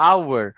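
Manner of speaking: "আওয়ার" opening on the vowel; silent h. Shown as a demonstration. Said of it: "এইচ এর উচ্চারণটা silent আছে".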